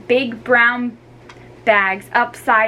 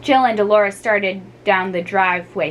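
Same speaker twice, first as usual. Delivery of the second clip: ordinary delivery, nothing marked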